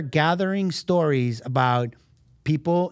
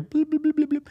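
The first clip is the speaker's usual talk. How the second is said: a squeaky, high little bloop noise, repeated; high playful bloop noises; 10 semitones higher than usual. Acted. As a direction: chirp it out like a cartoon sound effect. Making a flag-waving sound effect.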